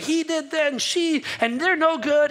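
mock gossip. Mocking blaming others.

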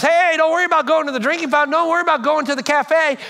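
fast, high, loud shout to a buddy; calling over concert noise. Acted. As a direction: yell it quickly over the crowd.

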